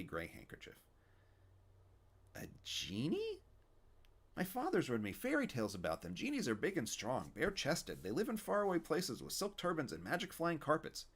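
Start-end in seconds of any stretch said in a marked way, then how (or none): 2.3-11.1 put-on higher, eager little boy; wide-eyed kid describing genies; young boy voice. Stanley voiced as a child.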